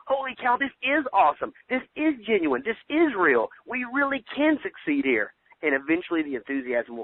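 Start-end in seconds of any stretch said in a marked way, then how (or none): 0.1-5.3 much higher, amazed burst; sudden excitement. Retold or imitated. Distributor realizing the company is real, thrilled. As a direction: it finally clicks, let it burst out. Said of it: "you'll be like".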